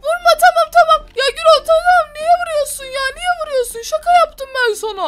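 wife pleading with her angry husband not to hit; mock pleading. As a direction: beg him to stop, half laughing.

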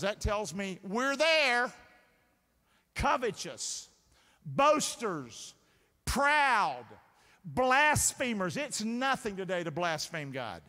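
emphatic, drawn-out sermon delivery; forceful preaching. Real, not acted. Preacher warning the congregation about the last days, forceful preaching. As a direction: drive each word home slowly, like a preacher. Preaches a list of sins from scripture.

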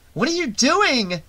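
high, disbelieving cry; incredulous shout. A narrator's reading. Vera to Shelley as he turns the car back, incredulous shout. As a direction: call out in shocked disbelief. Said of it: "Vera shouted incredulously".

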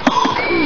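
loud sharp gasp; sudden audible intake of breath; shocked gasp. Real, not acted. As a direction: gasp hard in surprise. Startled during the game.

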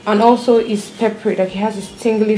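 stiff lips barely moving; talking with hardened clay mask; through a stiff face mask. Real, not acted. Seen 0: Thick dried paste covers whole face, lips move little while speaking.

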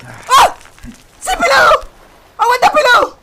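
high, loud cries of encouragement; excited high-pitched yelling; urgent shouting. Real, not acted. Urging game character on.

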